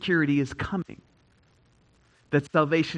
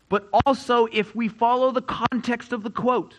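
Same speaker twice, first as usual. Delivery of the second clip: raised, higher preaching voice; 8 semitones higher, 4 dB louder than usual. Acted. Pastor preaching peace to the church, raised and higher. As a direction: preach it out to the congregation.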